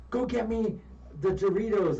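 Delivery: DJ voice. Retold or imitated. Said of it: "one of the D Js says".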